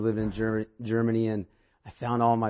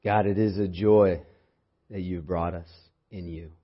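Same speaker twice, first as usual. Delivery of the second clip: soft, hushed prayer voice; 4 semitones lower, 3 dB quieter than usual. Acted. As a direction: pray quietly and reverently. Opening prayer before sermon.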